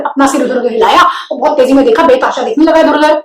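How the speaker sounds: ordinary delivery, nothing marked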